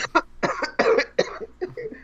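long rasping cough fit; chesty cough. Real, not acted. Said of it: "I'm coughing".

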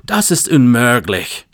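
voicing the stubborn German clerk refusing outright; emphatic German refusal. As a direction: slam the door on the request in German. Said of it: "he said very emphatically".

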